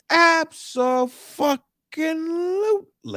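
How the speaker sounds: Abso-fucking-lutely stretched slowly, each part hit; emphatic. Real, not acted. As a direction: draw it out for maximum emphasis. Emphatic agreement.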